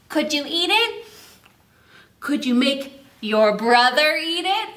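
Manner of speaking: ordinary talk, nothing marked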